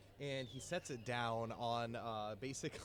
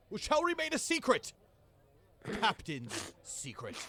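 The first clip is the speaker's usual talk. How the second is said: booming jolly pirate captain addressing the crew; rally the crew like a proud captain; 3 semitones higher, 6 dB louder than usual. Acted. Captain rallies his crew at the feast, jolly and booming.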